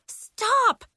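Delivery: high, loud command; urgent shriek to halt; crying out. A narrator's reading. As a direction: cry out in alarm. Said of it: "Nori cried".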